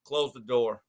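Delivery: low, curt order; stern coach. Retold or imitated. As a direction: coach ordering the team to leave without him, low and curt. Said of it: "And Jimmy goes".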